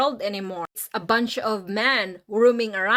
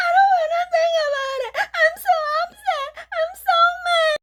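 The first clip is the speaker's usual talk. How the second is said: high, loud, fast mock sobbing; hammed-up crying; 6 dB louder, faster than usual. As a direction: wail the words like a weepy girlfriend. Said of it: "she would cry for you".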